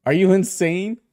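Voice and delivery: loud, high incredulous outburst; outraged. Acted. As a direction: react sharply to a reckless suggestion. Outraged at risky suggestion.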